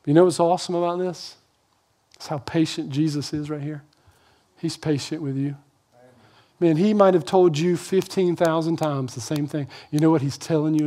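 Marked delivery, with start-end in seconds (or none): 0.0-5.6 soft, confiding preacher aside; hushed aside. Real, not acted. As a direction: drop much softer and close, like a secret. Preacher drops to intimate aside.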